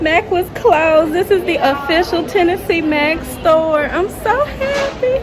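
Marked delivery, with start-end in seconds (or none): 3.9-5.2 high, delighted squeal; squealing joy. Real, not acted. Vlogger overjoyed at the reopened Mac store, high squeal. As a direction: squeal it with glee.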